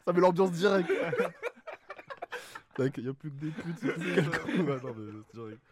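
high cackling laughter; laughing. Real, not acted. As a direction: laugh hard between words. Cracks up at the joke.

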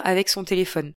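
usual speaking voice

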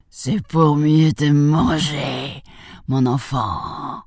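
slow, menacing wolf roar; drawn-out big bad wolf voice; howling wolf. A narrator's reading. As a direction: roar it out word by word as the hungry wolf. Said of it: "hurla le loup".

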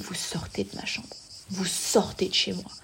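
very soft, restrained order; colère contenue à voix basse. Retold or imitated. Ordre donné à voix basse. (colère froide, presque chuchotée)

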